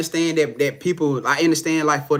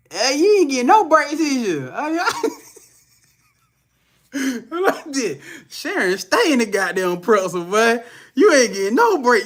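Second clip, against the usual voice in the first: laughing; 7 semitones higher than usual. Real, not acted. Teasing viewer, laughing.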